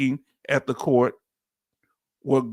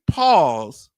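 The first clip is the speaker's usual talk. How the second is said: mock preacher pronouncement; 4 dB louder than usual. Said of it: "He say".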